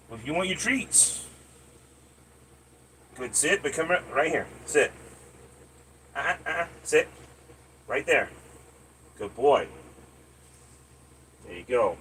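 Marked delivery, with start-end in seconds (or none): none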